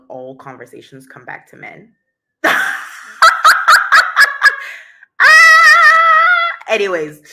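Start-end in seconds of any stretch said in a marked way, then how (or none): 2.4-5.1 big high burst of laughter; loud laugh. Real, not acted. Big laugh at her own joke. 5.2-6.6 loud high "Ah!" out of a laugh; high laughing cry. Real, not acted.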